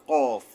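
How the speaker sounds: কফ loud and heavy from the tongue root; thick, heavy articulation. Shown as a demonstration. Teacher models the two-dot letter, loud thick কফ. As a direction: hit কফ hard and thick, far back at the tongue root. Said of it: "মোটাভাবে আদায় হবে".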